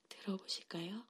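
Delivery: normal talking voice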